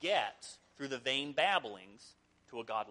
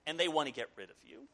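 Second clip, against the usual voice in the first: usual speaking voice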